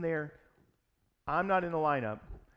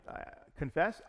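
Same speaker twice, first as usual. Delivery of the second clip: usual speaking voice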